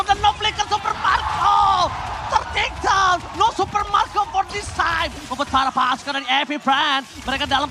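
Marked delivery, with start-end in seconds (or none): 0.0-5.1 rising shouted play-by-play call; esports caster. Real, not acted. Live casting hype.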